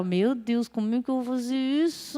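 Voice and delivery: hushed, mock-helpless inner plea; inner panic. Her inner self asks God for help, quiet panicked aside. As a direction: wail it very softly, stretching the end. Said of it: "Mas por dentro eu tava".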